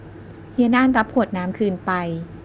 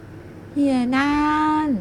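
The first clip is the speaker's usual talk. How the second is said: drawn-out sulky call; 7 semitones higher, much slower than usual. Acted. Girl sulking at the teasing man, drawn-out whiny name. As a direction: stretch the name out long and pouty. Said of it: "ฉันลากเสียงยาวหน้างั้ม".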